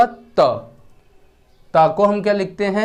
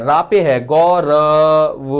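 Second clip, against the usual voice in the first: slow, spelled-out "Gaurav" that stops on the v; slow demo; 3 dB louder, much slower than usual. Shown as a demonstration. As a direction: draw "रा पे है गौरव" out slowly for the class. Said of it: "वहाँ पे उच्चारण नहीं है". (धीरे-धीरे समझाते हुए "गौरव")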